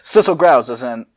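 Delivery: ordinary talk, nothing marked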